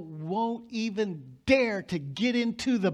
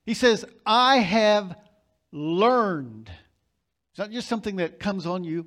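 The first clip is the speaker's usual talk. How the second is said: loud, driving sermon voice, pressing every point; fiery preaching; 3 semitones higher, 7 dB louder than usual. Acted. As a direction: preach it out full force to the congregation. Preaching at full volume.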